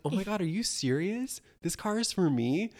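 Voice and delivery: high, breathless mock disbelief; mock daughter surprise. Daughter reacts to the car gift, lightly mock-stunned. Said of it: "her daughter's like".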